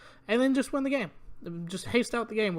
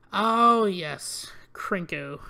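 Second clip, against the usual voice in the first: usual speaking voice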